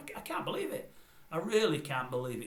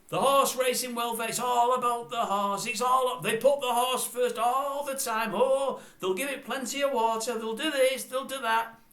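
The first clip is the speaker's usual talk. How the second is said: high, loud, drawn-out mock enthusiasm; mock gushing presenter; 11 semitones higher, 6 dB louder than usual. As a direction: parrot the presenter at full pitch. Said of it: "He says the same things every programme".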